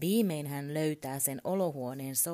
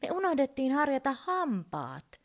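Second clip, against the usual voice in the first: put-on high, loud little boy's complaint; child calling out; 9 semitones higher, 5 dB louder than usual. A narrator's reading. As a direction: call it out urgently to dad. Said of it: "Mikko huutaa".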